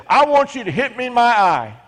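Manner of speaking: hammed-up child's plea; lightly mocking little boy; high, silly mock child voice. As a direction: pitch it up like a silly kid. Mocking imagined boy's claim.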